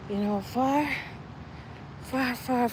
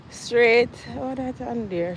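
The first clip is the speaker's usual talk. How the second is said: usual speaking voice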